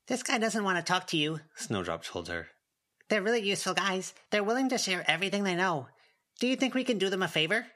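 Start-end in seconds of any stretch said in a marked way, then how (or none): 0.1-1.4 put-on higher, childlike matter-of-fact; lightly voiced little girl Snowdrop. A narrator's reading. Snowdrop telling Avery about the goblin, higher childlike voice. 3.1-7.7 put-on higher, childlike matter-of-fact; lightly voiced little girl Snowdrop. A narrator's reading. Snowdrop telling Avery about the goblin, higher childlike voice.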